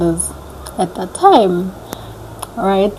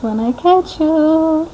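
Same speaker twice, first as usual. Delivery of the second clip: slow and higher taunt; high drawn threat; 8 semitones higher, slower than usual. Pretend. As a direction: stretch it out teasingly. First take of threat.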